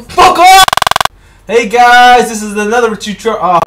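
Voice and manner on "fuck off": high, loud angry shout; furious shout at a blown take; frustrated yell. Real, not acted. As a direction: snap it out in frustration.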